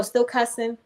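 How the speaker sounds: normal talking voice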